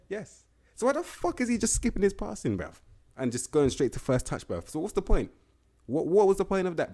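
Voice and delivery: high, loud, incredulous rant; exasperated rant. Real, not acted. Gamer rants at a player's missing passing, high and loud. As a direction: demand an answer, outraged and disbelieving. Ranting about skipped passing skill.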